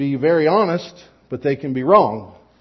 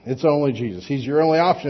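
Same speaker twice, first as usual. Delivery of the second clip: ordinary talk, nothing marked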